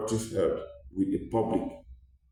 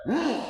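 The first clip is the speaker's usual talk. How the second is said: scornful hum; 14 semitones higher than usual. Retold or imitated. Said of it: "this mama instead reacted by".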